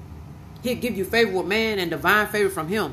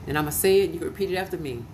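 ordinary delivery

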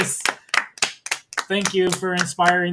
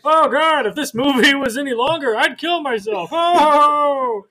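high heckler voice with put-on laugh; hammed-up old grump jeering; Muppet old guys; 7 semitones higher, 4 dB louder than usual. Old hecklers mock the movie, Muppet old-guy voice. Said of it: "they're like the old guys".

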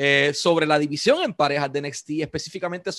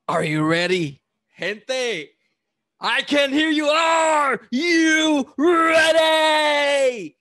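lucha libre announcer; 8 semitones higher, 5 dB louder, much slower than usual. Acted. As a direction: hype man rallying the crowd. Hyping the crowd as announcer.

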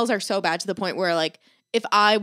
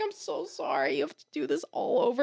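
tender apology; 2 semitones higher, 8 dB quieter than usual. Retold or imitated. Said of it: "I was like".

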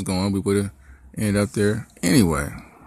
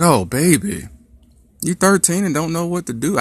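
put-on higher, teasing "oh baby"; mock pity; 9 semitones higher than usual. Dad's unsaid thought to his daughter, teasing and high. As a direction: tease gently, not really said. Mock-pitying imagined reply.

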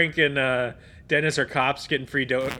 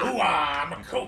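gung-ho coach voice; slower than usual. Acted. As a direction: play a fired-up coach, laughing. Pacino coach impression.

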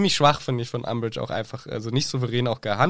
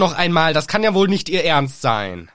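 louder, higher mock outrage; hammed-up indignation; indignant scolding; 3 semitones higher, 5 dB louder than usual. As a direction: scold like an appalled teacher. Teacher scolding the confessing pupil.

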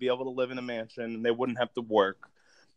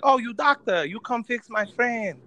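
put-on higher-pitched caricature of a Vietnamese accent; mock Vietnamese accent; 8 semitones higher, 3 dB louder than usual. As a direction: imitate a movie character's exaggerated Vietnamese accent. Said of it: "just do a racist accent".

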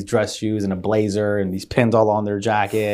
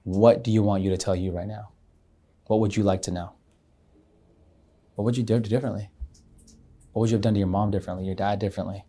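guided meditation voice; 2 semitones lower, 7 dB quieter than usual. Retold or imitated. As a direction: guide them down the path, softly. Retold guided meditation continues.

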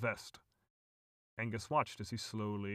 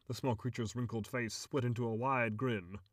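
plain reading voice

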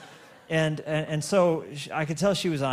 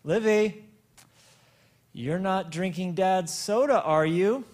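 calling out; 4 semitones higher, 4 dB louder than usual. Retold or imitated. Without turning around, calling out.

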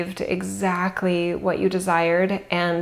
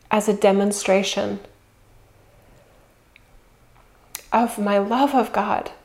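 spoken affirmation. Real, not acted.

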